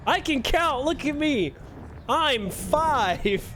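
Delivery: childish self-mockery. Mocking himself after miscounting.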